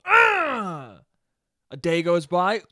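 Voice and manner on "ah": high mock scream of frustration; hammed-up exasperated "ah"; frustrated scream. Customer told still nobody knows, frustrated scream. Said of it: "And then you get mad".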